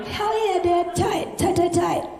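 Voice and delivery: high-pitched "tight, tight, tight"; mock supportive cheer. Comic to emotional dad, high mock-supportive "tight, tight". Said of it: "I'm like".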